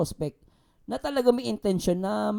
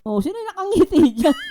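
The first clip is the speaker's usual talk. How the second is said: high, loud disbelieving challenge; indignant, pitched-up questioning; incredulous protest; 7 semitones higher, 5 dB louder than usual. Real, not acted. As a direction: protest in a much higher voice. Disputing claim that someone smiled.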